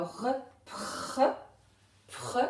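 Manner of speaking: "r" and "pr" as bare sounds, p bursting into a raspy French r; isolated letter sounds. Shown as a demonstration. Teacher breaking down a syllable for pupils, "pr" sounded out slowly. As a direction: sound out "r", then blend "pr" without letter names. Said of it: "on entend d'abord le p".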